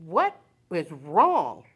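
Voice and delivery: slow, drawn-out "what is wrong"; exasperated question. Retold or imitated. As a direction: stretch each word in mock exasperation. Retells her exasperated question to nurses.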